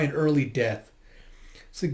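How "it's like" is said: normal talking voice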